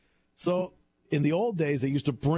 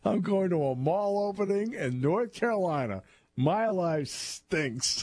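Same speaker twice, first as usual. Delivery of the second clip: higher, very slow, drawn-out "My life stinks"; mock glum Gilligan; 6 semitones higher, slower than usual. Host repeating Gilligan's sad line to listeners, slow and glum. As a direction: savour the punchline like a glum castaway.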